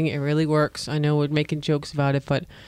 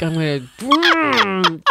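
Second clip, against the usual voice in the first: slow, drawn-out menacing joke voice; mock menacing threat; 3 semitones higher than usual. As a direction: savour the threat slowly, like a villain. Joking menace about tickle annoyance.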